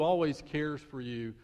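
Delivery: normal talking voice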